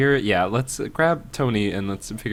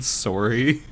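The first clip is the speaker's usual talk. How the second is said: Canadian "sorry" with a rounded first vowel; light Canadian accent. Acted. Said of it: "like, a Canadian accent".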